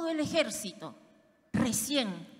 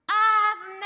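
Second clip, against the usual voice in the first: a loud, higher shout across the distance; shouted call; 7 semitones higher, 11 dB louder than usual. Acted. David shouting to Abner from afar, loud call. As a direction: call out to the general from far off. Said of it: "y grita".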